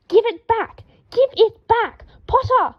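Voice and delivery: high, loud frantic yelling; high, loud frantic cries; shouting. A narrator's reading. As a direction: chase the ferret screaming at it. Draco chasing ferret, shouting.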